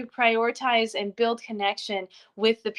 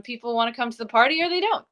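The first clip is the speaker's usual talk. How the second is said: ordinary talk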